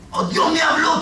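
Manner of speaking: high-pitched mock excitement; imitating a thrilled gossip, pitched high; excited gossip. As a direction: blurt it like hot news for the neighbour. Mimics believer running to tell neighbour.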